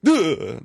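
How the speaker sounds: hammed-up shock noise; mock dramatic sting. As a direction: punch it out like a big movie reveal. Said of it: "it would be, like, a moment where".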